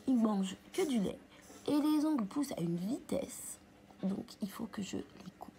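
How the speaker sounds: whispering over a crib. Real, not acted. Filming by sleeping baby.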